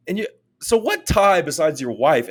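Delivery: exaggerated disbelief; incredulous. Mocking a Texas fan's reason.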